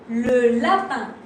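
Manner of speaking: "le lapin" slowly, with nasal French ending; French pronunciation. Shown as a demonstration. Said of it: "sounds like one, two, three, one".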